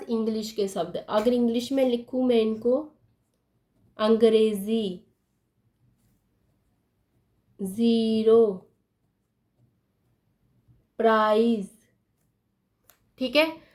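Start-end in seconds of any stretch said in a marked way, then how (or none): 4.0-11.7 अंग्रेजी, ज़ीरो, प्राइज slowly, each with a buzzed z; drawn-out "angrezi, zeero, praaiz"; z sound. Shown as a demonstration. Teacher reads the English words, slow clear z in each. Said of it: "ज पढ़ रहे हो".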